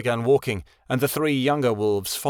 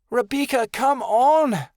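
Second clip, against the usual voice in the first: put-on higher, louder pleading young wolf; higher, louder plea to her friend; 14 semitones higher, 5 dB louder, slower than usual. A narrator's reading. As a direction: beg her to come away. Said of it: "Adelaide pleaded".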